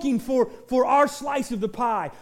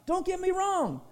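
normal talking voice

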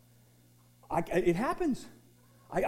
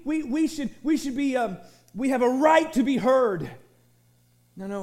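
ordinary talk, nothing marked

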